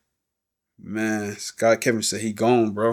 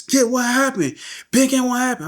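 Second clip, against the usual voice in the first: high-pitched, desperate questioning; frantic relatives; 13 semitones higher than usual. Retold or imitated. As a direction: imitate distraught family crying out for answers. Frantic relatives at crime scene.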